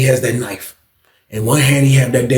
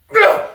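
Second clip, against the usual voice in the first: high effortful grunt; a grunt of pulling with all your strength; straining grunt. Retold or imitated. Straining to yank the door.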